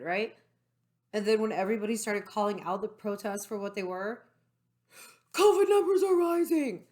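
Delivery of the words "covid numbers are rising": high, loud mock alarm headline; mock news alarm. Vlogger mocking the news covid scare, in a high loud mock alarm. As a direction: shout it like a scaremongering newscaster.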